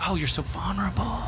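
breathy mock whisper of gushing praise. Mocking how the community praises men, whispered. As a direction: coo it like a fawning admirer. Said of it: "we're rewarded like".

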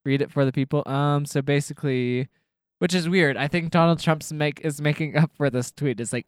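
ordinary talk

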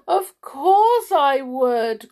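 high, loud, slow wail; crying out. A narrator's reading. King answers whether he'd like happiness, crying. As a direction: cry it out miserably. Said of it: "cried the king".

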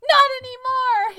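very high, loud yell; echoed battle cry. A narrator's reading. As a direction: shout it back with dramatic fervour. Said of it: "he replied".

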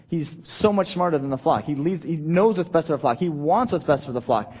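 usual speaking voice

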